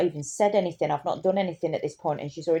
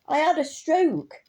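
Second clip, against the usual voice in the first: slow stroke speech; 8 semitones higher, slower than usual. Retold or imitated.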